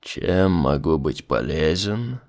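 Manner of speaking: deep, slow demon voice; slow low courteous demon. Acted. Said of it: "продолжал демон".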